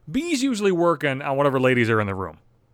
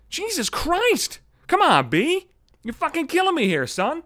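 exasperated; 11 semitones higher, slower than usual. Retold or imitated. Exasperated at bartender's question.

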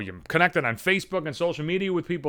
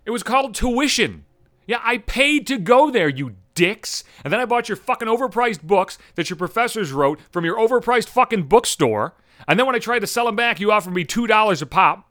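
ordinary talk, nothing marked

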